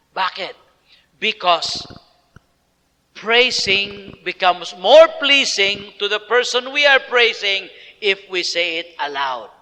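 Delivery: ordinary talk, nothing marked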